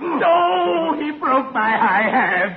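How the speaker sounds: high-pitched comic howl of pain; pained wail. Acted. Accomplice hurt by the sailor in the fight, pained wail. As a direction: cry out, hammed-up and hurt. Injured in the scuffle.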